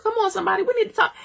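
fired-up preacher rallying the church; preacher's call. Real, not acted. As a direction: cry out to the congregation. Preacher calling out to congregation.

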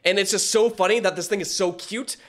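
ordinary talk, nothing marked

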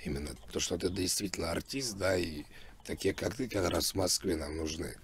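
normal talking voice

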